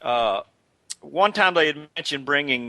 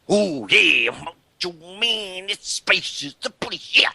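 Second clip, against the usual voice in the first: high, drawn-out gravelly promo voice, slowing on the name; Macho Man impression; 6 semitones higher, slower than usual. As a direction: channel the wrestler's raspy catchphrase delivery. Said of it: "the news of Randy's passing".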